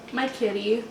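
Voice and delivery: normal talking voice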